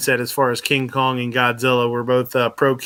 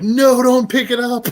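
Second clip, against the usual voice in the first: high, loud, stretched shout of no; re-enacted yell; 11 semitones higher, 4 dB louder, slower than usual. Retelling to the hosts how he yelled at the cook. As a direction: yell it like the whole kitchen hears. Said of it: "they heard me yell".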